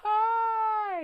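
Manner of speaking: very high, drawn-out mock greeting; flirty greeting. Glamorous Martha May appearing, high cooing imitation. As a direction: sing out a long falsetto hello. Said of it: "And it's just".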